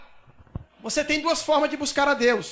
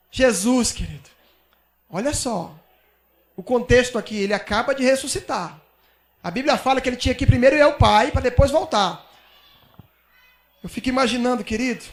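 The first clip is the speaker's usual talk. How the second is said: usual speaking voice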